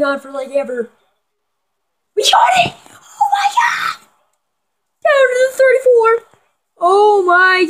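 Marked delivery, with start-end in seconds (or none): 2.2-4.1 very high screaming cheer; ecstatic shout. Real, not acted. Celebrating a big gain. 5.0-7.7 excited. Real, not acted. Still excited after big gain.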